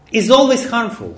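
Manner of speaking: ordinary talk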